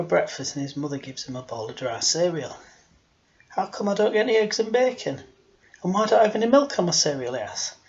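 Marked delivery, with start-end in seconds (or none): 3.5-7.3 higher-pitched put-on child's complaint; complaining little boy. Boy asking mother about his dry cereal, mildly indignant. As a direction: lightly voice a boy querying his breakfast.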